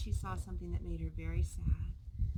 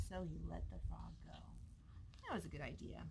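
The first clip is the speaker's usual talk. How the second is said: whispering; 5 semitones lower, 12 dB quieter than usual. A narrator's reading. Soft storytelling aside.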